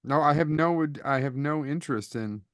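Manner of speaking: ordinary delivery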